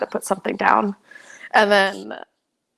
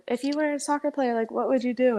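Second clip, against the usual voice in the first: lightly mimicked question, higher-pitched and quick; imitating curious askers; 6 semitones higher, faster than usual. People always ask the soccer player, imitated questioning.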